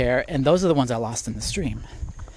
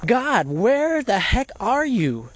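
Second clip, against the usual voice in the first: exasperated plea to God; 8 semitones higher, slower than usual. Retold or imitated. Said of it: "David is constantly like".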